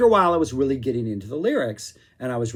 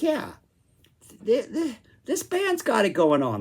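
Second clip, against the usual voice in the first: excited; 9 semitones higher than usual. Retold or imitated. Excited reaction to album.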